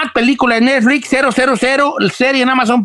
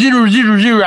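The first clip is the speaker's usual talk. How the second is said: English zero; faster than usual. Shown as a demonstration. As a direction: model the English way. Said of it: "hágale entonces". (zero en inglés, más fuerte)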